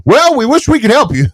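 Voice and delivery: high-pitched fake-sorry brush-off; mock sympathy. Co-host imitating insurer refusing to help, mock sympathy.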